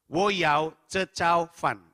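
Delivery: very slow, each syllable set apart; slow, careful Mandarin. Retold or imitated. Customer repeats his order to a silent waiter, slowly and carefully. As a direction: say it slowly so they can follow. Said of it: "Perasaan udah pelan-pelan ngomongnya".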